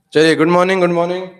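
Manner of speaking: cheerful loud good morning to the class; loud greeting. Real, not acted. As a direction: greet the whole class loudly. Teacher greets class loudly. (ज़ोर से अभिवादन)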